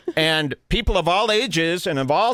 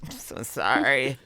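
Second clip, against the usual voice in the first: soft, slow mock apology; meek apology; 3 semitones lower, 5 dB quieter than usual. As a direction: imitate a feeble, apologetic girl. Sick girl apologizing, voiced.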